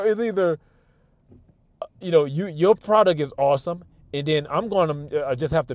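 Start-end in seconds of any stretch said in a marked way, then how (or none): none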